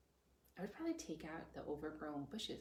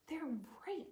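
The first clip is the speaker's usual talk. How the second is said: whisper; 9 semitones higher than usual. Real, not acted. Aside to parents, whispered.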